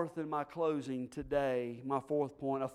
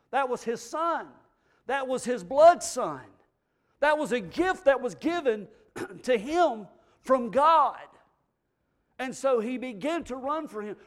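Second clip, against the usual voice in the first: raised, rising preacher's voice, words stretched for emphasis; fervent preaching; 9 semitones higher, 9 dB louder than usual. Acted. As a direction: build the congregation's fervour. Preacher builds to fervent pitch.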